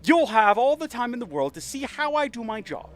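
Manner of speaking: high, hammed-up New York gangster menace; put-on gangster accent. Gad threatening Powell with a private room, gangster accent. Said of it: "like a gangster".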